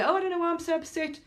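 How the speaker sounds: high, whiny mock bewilderment; imitating a confused, upset person; mock upset whine. Mimicking confused upset people.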